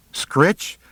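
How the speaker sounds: put-on a boy panicking at a feared name; rising panic. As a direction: climb into terrified disbelief. Boy realizes who lives there.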